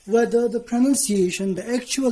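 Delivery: ordinary talk, nothing marked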